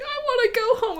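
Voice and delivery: hammed-up blubbering through laughter; imitating her sister crying on FaceTime, mock sobbing.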